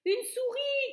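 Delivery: high, loud frantic cry; shout. A narrator's reading. Cook alerting the kitchen, high loud cry. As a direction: yell it as the mouse lands. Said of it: "criait-il".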